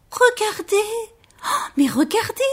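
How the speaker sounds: high, gasping little-bear voice; astonished Baby Bear. A narrator's reading. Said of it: "s'exclama bébé ours étonné". (bébé ours étonné, voix aiguë)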